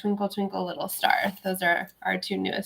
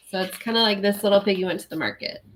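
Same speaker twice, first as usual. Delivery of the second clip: ordinary talk, nothing marked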